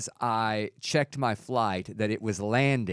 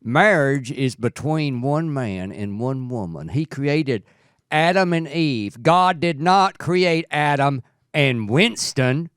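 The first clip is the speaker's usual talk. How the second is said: slow, louder mock accent of the Tennessee lawmaker; put-on accent; 3 semitones higher, 6 dB louder, slower than usual. Co-host reads the lawmaker's quote properly, in a slow mock accent. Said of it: "you didn't do the accent".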